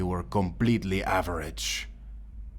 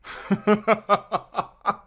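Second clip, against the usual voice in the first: high, gleeful villain cackle; evil laugh; 11 semitones higher than usual. Acted. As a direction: laugh down at her through the bars. Villain taunting his prisoner.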